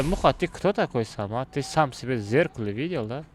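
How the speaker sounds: ordinary delivery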